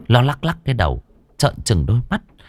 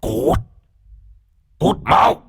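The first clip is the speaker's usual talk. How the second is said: rasping, menacing demon growl; booming possessed voice; 3 semitones higher, much slower than usual. A narrator's reading. Possessed Lan orders the man out, rasping growl. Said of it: "cất giọng ồm ồm".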